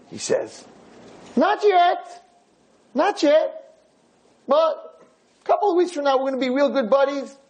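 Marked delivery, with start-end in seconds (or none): 1.4-7.4 put-on high, upbeat promising old man; cheery old man. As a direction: promise it like a hopeful friend. Lefkowitz promising friendship to Friedman.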